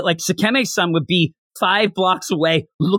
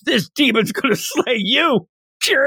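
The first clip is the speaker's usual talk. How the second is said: laughing threat; 7 semitones higher than usual. Retold or imitated. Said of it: "Manaka goes".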